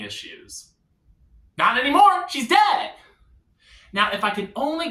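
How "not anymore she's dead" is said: high, loud triumphant cry; exaggerated jubilant outburst; gleeful shout. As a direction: crow it out with mock joy. Gleeful punchline.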